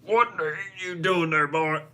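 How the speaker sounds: hammed-up trucker calling someone out; American trucker. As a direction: put on a trucker voice and yell it out. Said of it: "sounds about right for an American trucker".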